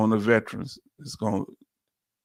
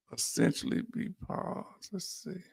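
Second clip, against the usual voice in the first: "Essentially be paused" whispered, almost voiceless; whispered to himself; 3 semitones higher, 14 dB quieter than usual. Real, not acted. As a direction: mutter the words under your breath while reading. Rereading the phrase to himself.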